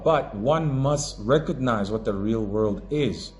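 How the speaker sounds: ordinary talk, nothing marked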